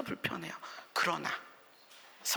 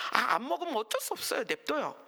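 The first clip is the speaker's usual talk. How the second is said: usual speaking voice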